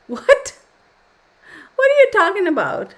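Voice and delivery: high, loud laughing disbelief; loud, high-pitched astonished question; incredulous. Real, not acted. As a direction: burst out in baffled amazement. Baffled by a viewer's film-line comment.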